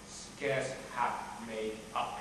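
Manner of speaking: low, slow and soft; spoken low and slowly, with self-contempt; bitter aside. Acted. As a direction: drop right down after the outburst. Outburst drops to self-contempt.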